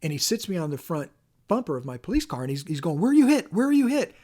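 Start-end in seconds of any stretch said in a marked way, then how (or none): 3.0-4.1 much higher and louder, frantic questioning; panicked shout. Retold or imitated. Best friend frantically asking the wounded officer, panicked shout. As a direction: yell it like a scared friend hunting for a bullet hole.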